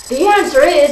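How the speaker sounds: jumps in high and loud; eager. Retold or imitated. Cutting in on the interviewer, an eager high blurt. Trying to answer, cut off.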